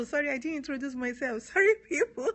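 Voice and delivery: high, loud real laugh breaking into the apology. Real, not acted. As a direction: apologise through a sheepish laugh. Laughs at forgetting her introduction.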